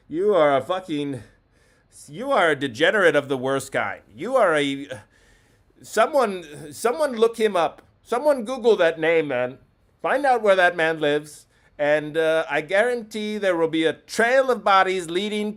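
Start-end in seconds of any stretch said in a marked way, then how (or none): none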